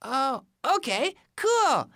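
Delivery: high, excited bunny voice; eager rabbit. Acted. Rabbit thinks up games for Ant, eager high voice. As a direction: stumble eagerly through ideas in a high voice.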